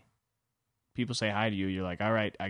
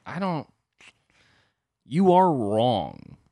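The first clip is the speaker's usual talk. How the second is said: slow, louder you are wrong; flat denial; 6 dB louder, much slower than usual. Retold or imitated. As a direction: insist she is wrong. Flatly disagreeing with girlfriend.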